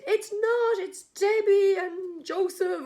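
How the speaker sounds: high, loud slow shout of the names; guests shouting corrections. Retold or imitated. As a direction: yell the right names up at her.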